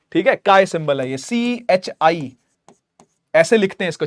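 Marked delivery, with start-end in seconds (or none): 1.2-2.4 letters spelled slowly. Shown as a demonstration. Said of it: "ये symbol को बोलते हैं काय".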